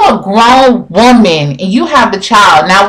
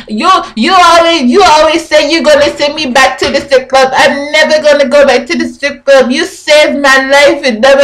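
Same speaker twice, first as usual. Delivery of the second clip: mocking Jamaican-style accent, pitched up and muffled; Jocelyn's accent; 6 semitones higher than usual. Vlogger repeats Jocelyn's line to viewers in mocking accent. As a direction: redo her accent as if bread is in the mouth. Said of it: "she just has the nastiest accent".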